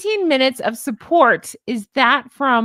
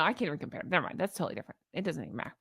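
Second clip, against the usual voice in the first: ordinary delivery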